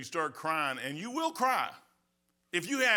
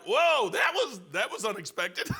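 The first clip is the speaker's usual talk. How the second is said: mock surprise; 6 semitones higher, 6 dB louder than usual. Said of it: "I'm like".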